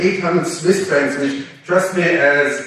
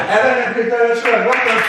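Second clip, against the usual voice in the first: laughing, higher, bright announcer call; excited welcome; 7 semitones higher than usual. Real, not acted. Host welcoming the famous couple onto the stage, excited and laughing. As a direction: bring them on stage with delight.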